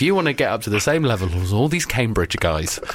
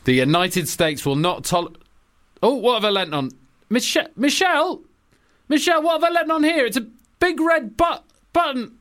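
Obama; 4 semitones higher than usual. Acted. Obama scolding Putin, then panicking over a button, much higher. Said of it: "I'm playing Obama".

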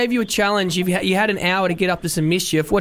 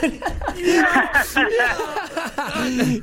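high burst of laughter; real laugh; 8 semitones higher than usual. Real, not acted. Host laughing at his own festival story. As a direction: laugh out at the punchline.

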